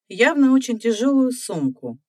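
usual speaking voice

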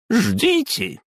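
high squealed command; squealing. Acted. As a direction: squeal it sharply. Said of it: "взвизгнула дама".

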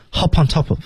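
high-pitched, "Hop on top of" in one unit; linked reading. Shown as a demonstration. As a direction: link each p of "Hop on top of" to the next vowel. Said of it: "Top of连读". (“Hop on top of”一口气连读)

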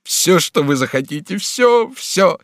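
high, loud sobbing surrender; tearful cry. A narrator's reading. Bonifas falls to his knees sobbing, tearful cry. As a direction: give in, pitch leaping on each repeat. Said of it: "вскричал Бонифас, падая на колени и рыдая".

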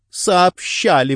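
high, drawn-out contradiction; exclaiming. A narrator's reading. Wife contradicts detective about the news, exclaiming. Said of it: "воскликнула Делайн".

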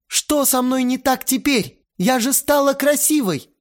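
high, wounded complaint; hurt girl. A narrator's reading. Lena asks Vova why she isn't enough, high and hurt. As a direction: demand what's wrong with you now.

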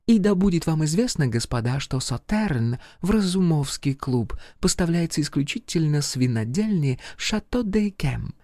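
high-pitched French lady voice; femme fatale. Acted. Charlotte's voice again.

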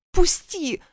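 high strained rasp; choked plea to let go; hoarse cry. A narrator's reading. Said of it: "прохрипела я". (хрипло)

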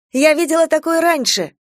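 excited high exclamation; exclaiming. A narrator's reading. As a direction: burst out with discovery. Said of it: "воскликнула я".

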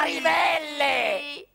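slow, crying shout with the voice breaking; anguished cry. Acted. Painter reaching the drills at the end of the list, anguished crying shout. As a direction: wail it out as the last straw.

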